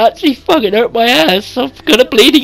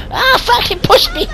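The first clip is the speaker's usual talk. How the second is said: panicked scream; 11 semitones higher than usual. Real, not acted. Grabbed by monster, screaming.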